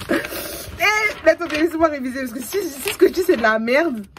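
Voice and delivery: high, loud, rushed plea; flustered protest. Real, not acted. Student asking viewers for time to revise, flustered high protest.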